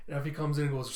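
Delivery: plain reading voice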